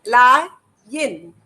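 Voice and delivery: La and yin said as separate beats; teacher splitting a name into La, yin; split into syllables. Shown as a demonstration. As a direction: pause between La and yin so children can count. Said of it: "How many syllables?".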